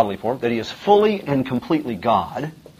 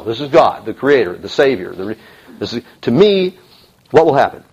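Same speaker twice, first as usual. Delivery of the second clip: normal talking voice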